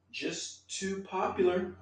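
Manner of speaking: slow, high and soft; drawn out high pitch. Real, not acted. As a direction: stretch it out in a squeaky voice. Mock complaint about many replies.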